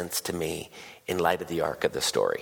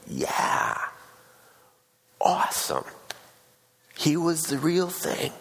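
mock awe; 7 semitones higher, slower than usual. Acts out congregation's impressed response.

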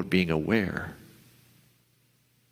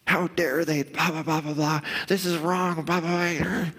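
mock outrage; 7 semitones higher than usual. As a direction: rant like a cartoon of outrage. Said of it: "What'd the bullshit part of your brain tell you?".

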